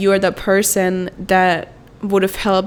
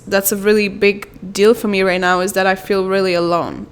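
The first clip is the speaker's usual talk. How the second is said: ordinary delivery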